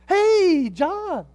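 high, loud mock greeting; calling out. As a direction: hail someone else to escape the conversation. Acts calling out to someone else.

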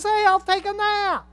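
the hare deciding to nap, carefree; lazy rabbit. Retold or imitated. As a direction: toss it off like a smug rabbit.

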